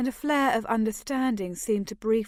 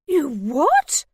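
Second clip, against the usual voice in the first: shrill outraged shriek, higher and louder; 6 semitones higher, 8 dB louder than usual. A narrator's reading. Mother Greta shrieking at her daughter's confession. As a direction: shriek it in disbelief. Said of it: "I had never actually heard Greta shriek".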